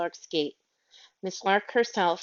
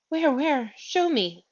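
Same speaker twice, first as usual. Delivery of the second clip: higher, louder, eager and breathless; breathless eagerness; 8 semitones higher, 4 dB louder, slower than usual. Acted. Miss Lark begging Michael to show her Andrew, breathless. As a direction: gasp it out, desperate to see her dog. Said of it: "said Miss Lark breathlessly".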